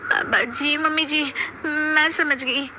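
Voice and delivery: high, slow, whimpering agreement; meek daughters-in-law. Acted. Daughters-in-law meekly agreeing with mother-in-law, high and whimpering. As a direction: give in timidly.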